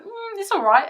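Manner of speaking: high, loud mock shrug of a voice; unimpressed meh. Showing viewers the bare eye, a mock unimpressed meh. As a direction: sound underwhelmed, like it's just okay.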